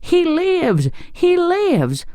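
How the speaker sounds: high witchy shriek of triumph; delighted scream. A narrator's reading. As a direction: squeal it, frantic with delight. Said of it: "she screamed".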